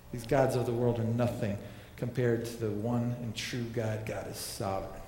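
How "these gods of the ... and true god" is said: ordinary talk, nothing marked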